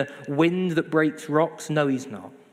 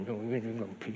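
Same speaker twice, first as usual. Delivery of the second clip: soft, grumbled imitation of sulking; mock grumpy; 4 semitones lower, 10 dB quieter than usual. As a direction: mumble it like a sulker. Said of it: "We get all grumpy".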